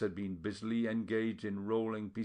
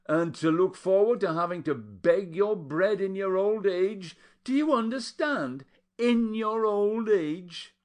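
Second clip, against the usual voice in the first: high pleading lament; 10 semitones higher, 6 dB louder than usual. A narrator's reading. Aunt Patricia's lament continues.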